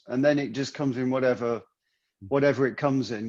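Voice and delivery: ordinary delivery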